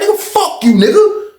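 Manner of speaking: high-pitched mock cussing out; retold cursing match. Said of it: "this cursing each other out".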